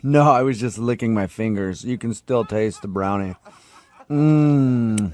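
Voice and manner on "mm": loud satisfied mmm; pleasured food moan. Acted. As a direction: savour it with a moan. Tasting brownie off his fingers.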